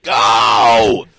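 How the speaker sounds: loud, slowed, crying shout; anguished yell. Retold or imitated. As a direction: howl at them to leave. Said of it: "he's like".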